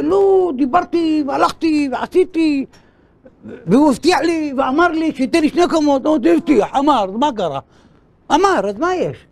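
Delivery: high-pitched, indignant shouted protest; acted shouting. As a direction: play a litigant shouting his complaint. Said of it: "מה אתה צועק".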